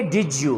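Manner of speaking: "Did you" joined as "did-ju"; low-pitched model of "Did you" with a j; j liaison. Shown as a demonstration. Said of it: "तो आप क्या कहेंगे".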